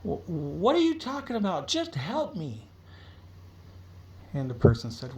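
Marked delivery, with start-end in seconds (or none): 0.0-2.7 high, bewildered begging, slowing at the end; confused, scared plea. Acted. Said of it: "She's like".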